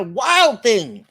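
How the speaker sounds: put-on loud growl at a naughty child; scolding storybook mother, loud and growly. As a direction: growl it out, punching WILD. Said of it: "she's growling at him".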